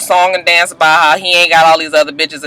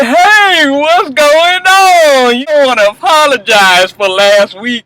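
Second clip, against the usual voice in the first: put-on higher voice for Shay Mack, drawn out on "what's going on"; mocking Shay Mack; 6 semitones higher, slower than usual. Vlogger imitating Shay Mack greeting [name], mock sweet. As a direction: mimic her fake-sweet greeting. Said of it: "She was just like".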